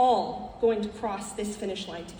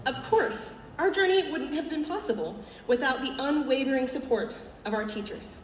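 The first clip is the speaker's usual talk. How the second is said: normal talking voice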